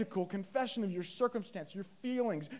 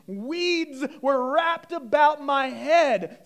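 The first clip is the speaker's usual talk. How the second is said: raised, louder preacher's reading; impassioned scripture reading; 3 semitones higher, 7 dB louder than usual. Acted. Preacher reading Jonah's prayer to the congregation, impassioned. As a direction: declaim the verse to the congregation. Reads scripture at full force.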